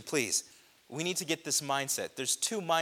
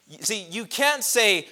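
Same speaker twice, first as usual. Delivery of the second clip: loud, high preaching burst; preaching at full force; 8 semitones higher, 8 dB louder than usual. Acted. Preacher building to the congregation, preaching at full force. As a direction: raise the roof on the congregation.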